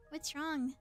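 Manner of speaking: ordinary talk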